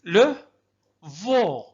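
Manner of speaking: syllable by syllable, slow; teacher drilling syllables; sounding out syllables. Shown as a demonstration. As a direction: break the French word into its parts. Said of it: "أحسنتم".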